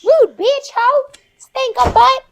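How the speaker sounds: put-on loud, high Southern-accented insults; bitchy Southern belle. Southern belle insults whoever walked through the shot, loud and sassy. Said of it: "The Southern Belle me is a real bitch".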